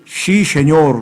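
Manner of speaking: put-on sí said as 씨 in a Gyeongsang accent. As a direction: Gyeongsang speaker's 씨 씨 세뇨. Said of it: "발음을 못 해서". (경상도 사투리로)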